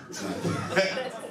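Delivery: laughing. Real, not acted. Backpedalling a joke.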